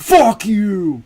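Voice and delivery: high, loud joking outburst; hammed-up trash talk, starting high and loud; playful mock insult. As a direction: yell it as over-the-top banter. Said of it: "nobody is serious when they're like".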